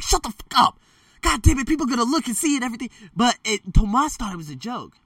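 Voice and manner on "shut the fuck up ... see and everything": urgent hushing snap. Retold or imitated. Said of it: "I was like".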